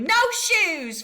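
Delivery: put-on high, loud little-kid refusal; stubborn toddler. A narrator's reading. Fudge refusing the new shoes at the store. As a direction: plant your feet and refuse. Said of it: "Fudge said".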